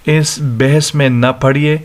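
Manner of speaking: ordinary delivery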